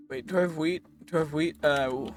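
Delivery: usual speaking voice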